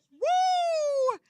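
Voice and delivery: high, loud drawn-out whoop; whooping woo. Real, not acted. Imagining talking back to her parents, whooping woo. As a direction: whoop at the very thought. Exclaims at the thought of backtalk.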